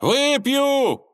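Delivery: sudden very high, loud yell. Acted. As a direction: bellow the word at full pitch. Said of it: "и вдруг как заорёт".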